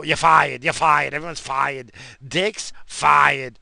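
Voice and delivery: loud, hammed-up "you're fired" boss bellow; mock firing shout. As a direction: bark the firings like a TV boss, half joking.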